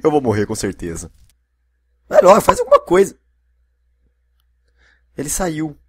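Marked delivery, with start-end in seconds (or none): 2.1-3.1 very high, loud taunting shout; high impatient yell. Real, not acted. Player taunting an enemy, high impatient yell. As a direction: goad them, hurry them up.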